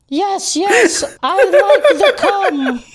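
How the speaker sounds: put-on high, slow old lady reading; Doris old lady voice. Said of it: "read it in her old lady voice".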